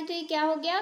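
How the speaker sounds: ordinary talk, nothing marked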